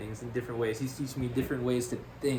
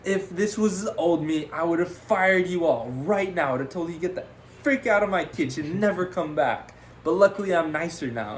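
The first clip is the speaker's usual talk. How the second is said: raised, higher voice, winding down the rant; still yelling chef; 6 semitones higher, 4 dB louder than usual. Retold or imitated. Chef finishing his rant at the new cooks, still yelling. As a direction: bark the threat, then ease off at the end. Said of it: "while he's yelling at us".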